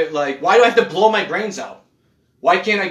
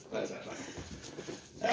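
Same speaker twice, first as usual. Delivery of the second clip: That's not funny whispered, slow and much softer; That's not funny as a drawn-out, soft, unvoiced whisper; 19 dB quieter, much slower than usual. Shown as a demonstration.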